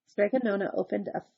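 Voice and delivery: plain reading voice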